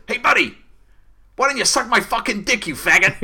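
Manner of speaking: high, loud yelled abuse; shouting insult. Retold or imitated. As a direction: yell it out the window. Van driver yelling from window.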